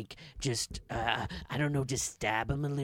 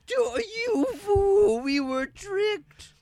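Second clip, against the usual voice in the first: high alarmed voices; 11 semitones higher, 5 dB louder, slower than usual. Acted. Ghosts realise they were tricked.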